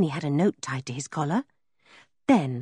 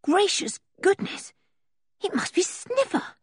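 surprised; 13 semitones higher than usual. Acted. Sudden relief in the dark.

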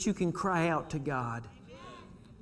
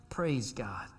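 whisper; 5 semitones lower, 7 dB quieter than usual. Real, not acted. Whispered praise.

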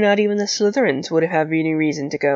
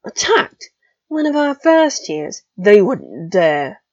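indignant disbelief; 4 dB louder, slower than usual. Acted. Older students indignant.

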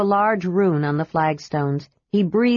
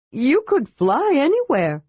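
high, bright kender voice; eager kender; 7 semitones higher than usual. A narrator's reading. Kender questions the dragon, high and eager. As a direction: pitch it up like a curious kender.

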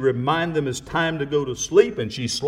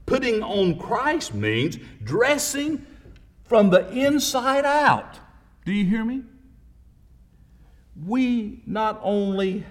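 preaching at full force; 4 semitones higher than usual. Acted. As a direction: build it up like a revival preacher. Preacher building to climax.

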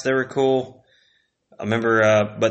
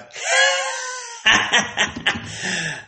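squealing laugh; high burst of laughter. Real, not acted.

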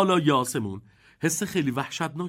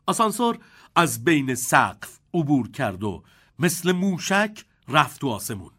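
ordinary delivery, nothing marked

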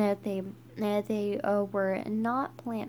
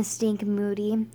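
ordinary delivery, nothing marked

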